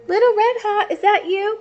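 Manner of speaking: high, quavery granny voice; old grandma voice. Acted. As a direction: voice the old grandma calling at the door. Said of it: "Grandma said".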